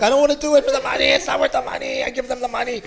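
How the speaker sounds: high, imitated tearful protest; hammed-up sobbing complaint, pitched high; mock crying. Shaman retells dreading the ceremony, mock crying. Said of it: "I'm, like, crying because".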